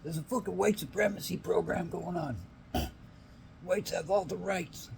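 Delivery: high put-on whiny complainer; mocking whiner.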